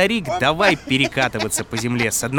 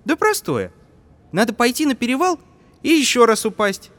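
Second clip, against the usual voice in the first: put-on boy voice; 12 semitones higher, 4 dB louder than usual.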